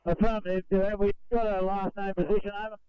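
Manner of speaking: tinny two-way radio transmission; crackly military radio. Real, not acted. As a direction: voice through a crackly handheld radio. Reply over two-way radio.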